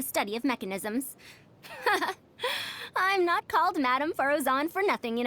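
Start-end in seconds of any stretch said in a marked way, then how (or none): 2.4-2.9 breathy proud chuckle. Acted. Proud boast about her title.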